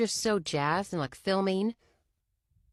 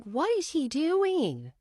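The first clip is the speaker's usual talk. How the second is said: baffled; 8 semitones higher, slower than usual. Retold or imitated. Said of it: "we're like".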